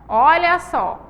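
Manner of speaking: usual speaking voice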